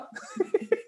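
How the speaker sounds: a burst of airy high laughter; high breathless laugh. Real, not acted. Reacting to the call.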